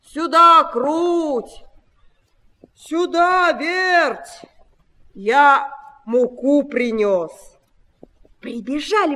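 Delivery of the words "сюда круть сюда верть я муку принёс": loud raised drawn-out call. A narrator's reading. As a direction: summon the mice from afar, stretching words. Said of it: "опять зовёт мышат".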